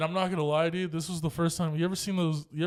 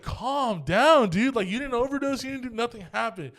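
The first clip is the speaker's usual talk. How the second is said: high, insistent "calm down"; raised-pitch pleading to calm down; exasperated reassurance; 8 semitones higher, 5 dB louder than usual. Retold or imitated. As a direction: imitate a fed-up cousin talking a friend down. Cousin urging panicked friend to calm.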